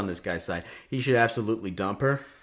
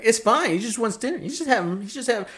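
mock dismissive girlfriend; 10 semitones higher than usual. Mocking the girlfriend's excuse.

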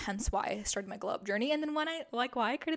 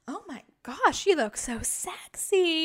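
higher and louder, imitating thrilled boyfriend; excited boyfriend compliment; 6 semitones higher, 7 dB louder, slower than usual. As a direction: gush over her new haircut like Zach did. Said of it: "he told me".

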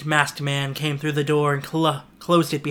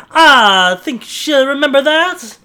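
mocking; 10 semitones higher, 11 dB louder, slower than usual. Noah mocking in the story.

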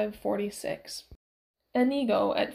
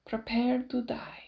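menacing whisper. Acted. Vengeful line in a duel.

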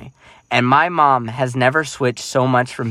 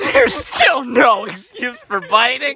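high, slow mocking laugh-through repeat; mock the line while cracking up; 11 semitones higher, slower than usual. Brother repeating the director's line through laughter, high and slow.

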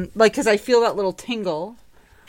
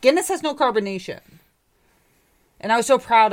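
ordinary talk, nothing marked

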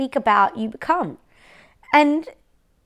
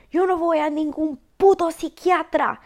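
imitating mom's blow-up in Spanish, higher and louder; mother's Spanish outburst; 5 semitones higher, 4 dB louder than usual. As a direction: snap it out as the furious mother. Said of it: "she says it in Spanish".

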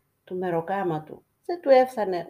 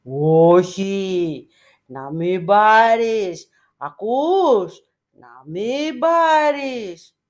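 slow, booming divine voice, much louder; thunderous voice; 9 dB louder, much slower than usual. A narrator's reading. The icon of Christ forbids the beggar, booming. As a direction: boom each word down from above like thunder. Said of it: "Μια βροντερή φωνή ακούστηκε".

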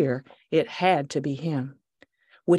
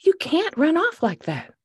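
exclaimed; 10 semitones higher than usual. Acted. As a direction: exclaim at the dog. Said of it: "she exclaimed".

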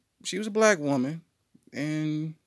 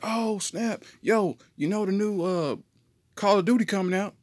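put-on high excited kid; 8 semitones higher than usual. Friends voiced excited.

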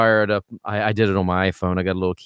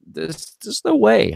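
disbelief; 10 semitones higher, slower than usual. Retold or imitated. Teacher's disbelief at student's claim.

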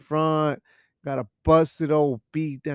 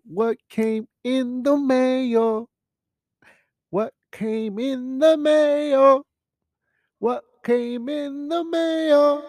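higher, slow drawn-out teasing question; mock sing-song question; 10 semitones higher, 6 dB louder, slower than usual. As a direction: tirala lunga, sempre più acuta. Repeats question in rising mock voice.

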